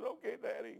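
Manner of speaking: tearful toddler giving in to Daddy, imitated; imitated crying child. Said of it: "And she's crying. She goes".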